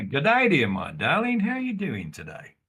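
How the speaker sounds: loud, flirty charmer calling out; charming greeting. Acted. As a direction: win the old lady over loudly. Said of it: "I kind of go across there and say".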